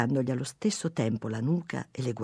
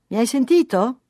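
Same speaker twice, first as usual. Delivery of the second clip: high, raised voice from another room; calling out; 9 semitones higher, 8 dB louder than usual. A narrator's reading. Wife in the kitchen to husband, calling out. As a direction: shout it through to him. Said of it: "gridò lei dalla cucina".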